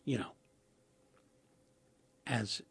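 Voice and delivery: usual speaking voice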